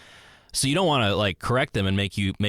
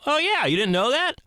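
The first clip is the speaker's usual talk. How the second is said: much higher, amused disbelief; surprised. Retold or imitated. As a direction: tease them in surprise. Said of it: "And you go".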